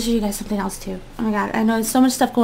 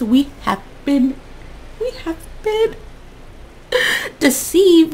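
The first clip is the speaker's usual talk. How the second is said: mock dramatic; 5 semitones higher, 3 dB louder than usual.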